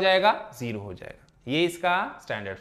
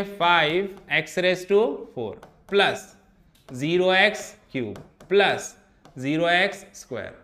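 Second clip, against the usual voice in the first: very slow, spelling out each term for the class; slow dictation; much slower than usual. Shown as a demonstration. Teacher dictating the coefficient form to students, very slowly. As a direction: dictate every term slowly, zeros included. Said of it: "तो क्या लिखेंगे?".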